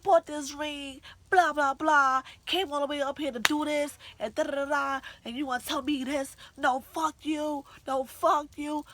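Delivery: high, loud mock tirade; imitated screaming rant. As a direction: yell it like a furious boyfriend going off. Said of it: "he's legit just screaming".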